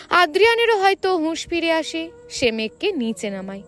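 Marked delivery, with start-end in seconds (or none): none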